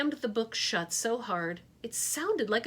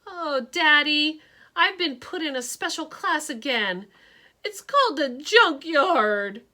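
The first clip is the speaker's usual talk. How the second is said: crying to dad; 7 semitones higher, 9 dB louder than usual. Acted. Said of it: "I finally burst into tears".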